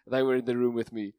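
usual speaking voice

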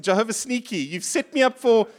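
I didn't sign up for this, hammed up; a hint of sulky grievance; indignant complaint. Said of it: "as someone once said".

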